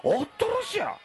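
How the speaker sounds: high-pitched startled Uwajima dialect exclamation; dialect shock exclamation. Shown as a demonstration. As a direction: blurt it out like you're truly startled. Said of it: "こんな感じ".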